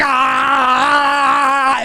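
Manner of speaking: high mock yell of the crowd; mock crowd shout. Mocking everybody in the theater with a high yell. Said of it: "And everybody's like".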